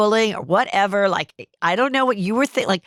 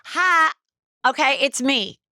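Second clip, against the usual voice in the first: higher bright mock TikTok voice; mocking the girl's video; 6 semitones higher than usual. Retelling the viral girl's TikTok, mocking her higher voice. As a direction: imitate the girl starting her video, high and chirpy. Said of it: "that girl's like".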